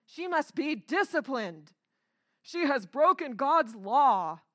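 loud, stern hellfire pronouncement; mock fire-and-brimstone preacher; condemning pulpit preacher. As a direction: thunder judgment from the pulpit. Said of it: "he's in the pulpit saying".

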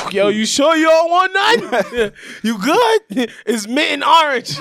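high, loud and slow, coaxing a date; mock persuasive date voice. To a date who won't smoke hookah, a high hammed-up coax.

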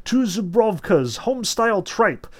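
high, loud food order called out; commanding order call. A narrator's reading. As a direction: call orders out as if through a megaphone. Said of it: "a voice commanded".

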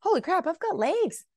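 very high, marveling exclamations; elated gratitude. Acted. Celebrating small everyday moments, high and elated. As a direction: voice climbing with delight at each small win.

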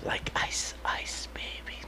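whisper. Real, not acted. Host whispering a joke to cohost, whisper. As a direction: whisper it like a secret punchline.

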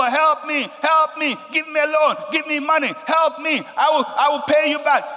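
high, loud begging of people; mock pleading. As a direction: imitate a desperate borrower, hammed up. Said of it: "You've been crying to people".

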